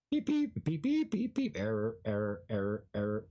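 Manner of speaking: robotic beeping, then error calls; malfunctioning machine voice calling error; glitching robot. Acted. System malfunction beeps.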